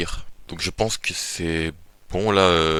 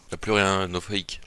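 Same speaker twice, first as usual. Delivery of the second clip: usual speaking voice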